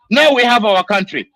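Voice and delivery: sudden loud burst of anger; loud outburst. Real, not acted. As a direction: throw the line out hard in real anger. Angry rant about his people.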